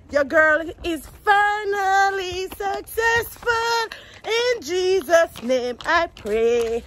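high, slow triumphant announcement. Real, not acted. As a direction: announce victory proudly. Triumphant celebration.

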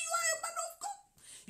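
put-on exalted, ringing French-accented English; soaring proclamation. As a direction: shout it out as a blessing. Repeats it, proclaiming.

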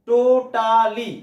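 টো-টা-লি, very slow; three stretched syllables on টোটালি. Shown as a demonstration. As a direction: draw টোটালি out in three clear beats. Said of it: "এরকম তিন প্রকারের শব্দ".

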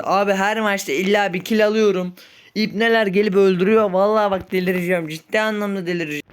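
loud, high, fast ranting meltdown; mini nervous breakdown. Real, not acted. Gamer ranting after dying again, loud frantic meltdown. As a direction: lose it completely, raging at the game. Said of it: "Ufak çaklı bir sinir krizi".

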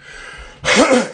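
a burst of high, loud laughter. Real, not acted. Laughing at her own skit plans, loud high laugh. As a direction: really crack up, high and loud.

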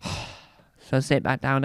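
usual speaking voice

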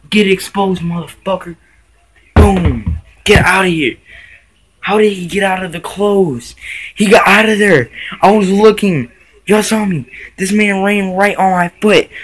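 loud, high, agitated outburst; worked-up alarm. Real, not acted. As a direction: exclaim it, still rattled by the scare. Worked up after moth ran over foot.

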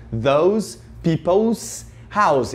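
Those people's house said very slowly, each word spaced; slow careful example. Shown as a demonstration. Teacher models the possessive for students, very slow. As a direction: spell out the sentence slowly for learners. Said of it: "Esse people's tem apóstrofo S".

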